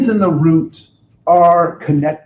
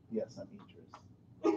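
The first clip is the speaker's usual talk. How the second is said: very soft, low, slow mock confession; hushed pretence; 7 semitones lower, 21 dB quieter, slower than usual. Claiming to the stranger to be Idris, hushed mock confession. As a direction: drop almost to a whisper, keeping up the act.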